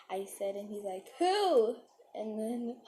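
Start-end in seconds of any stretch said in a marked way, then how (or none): 1.2-1.8 loud, high acted-out question; eager surprise. Storyteller as the boy learning she knows the girl, loud surprised demand. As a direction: burst out with it, pitched way up.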